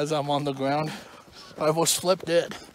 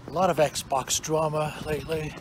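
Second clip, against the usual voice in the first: usual speaking voice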